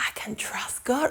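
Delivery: gasp, then a hushed whisper; very soft, voiceless realisation; whispered relief. Acted. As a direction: breathe it out softly, relieved. Relief after being weighed down.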